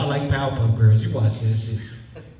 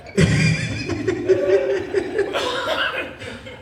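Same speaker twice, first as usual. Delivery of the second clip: high wheezing laugh. Real, not acted. Laughs after punchline.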